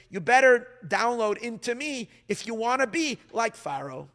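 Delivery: high, loud preacher's build; preaching at full force. Acted. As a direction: drive every phrase home like a pulpit climax. Preaching the Yehuda–Yosef discourse.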